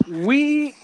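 high, stretched announcer call; ringmaster. Acted. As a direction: hold the word like a showman. Drawn-out announcer word.

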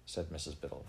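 plain reading voice

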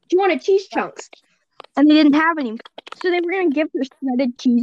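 ordinary delivery

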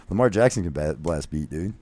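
ordinary talk, nothing marked

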